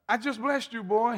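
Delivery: put-on an old father caught off guard; startled father. Isaac to Esau, stunned he already blessed someone.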